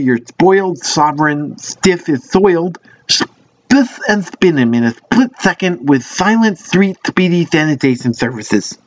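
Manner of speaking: slow garbled ad read; tongue-twister rush. Shown as a demonstration. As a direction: fumble the tongue twister slowly. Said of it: "couldn't even understand that last part".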